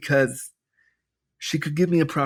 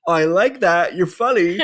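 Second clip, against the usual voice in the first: laughing; 3 semitones higher, 4 dB louder than usual. Retold or imitated. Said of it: "I just laughed and I was like".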